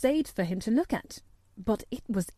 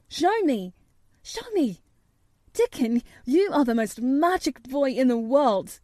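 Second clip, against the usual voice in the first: higher, eager pleading that grows louder; beg, then burst out in admiration; thrilled boy's rising exclamation; excited boy; 4 semitones higher, 4 dB louder than usual. Acted. Colin excitedly begs Dickon.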